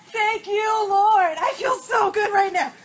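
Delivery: high, loud thankful cheer; joyful shout. Real, not acted. Angler thanking God for his biggest bass, joyful shout.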